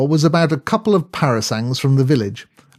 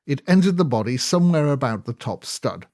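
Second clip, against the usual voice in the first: plain reading voice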